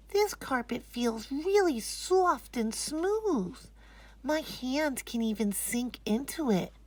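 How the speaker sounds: put-on high, slow and hushed child wonder. Anisa telling Mommy about the carpet, dreamy child voice.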